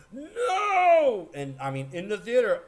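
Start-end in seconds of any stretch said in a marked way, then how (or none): 0.1-1.3 high, loud drawn-out wail; big, high-pitched anguished "no"; retold cry of disbelief. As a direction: reenact a stunned moviegoer's scream. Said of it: "And you just look and went".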